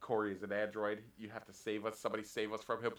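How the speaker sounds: deep mock plea. Pleading mock android.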